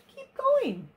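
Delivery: high-pitched call to keep going; urging onward. A narrator's reading. Elmer leading the elephants onward, urging them in a high voice.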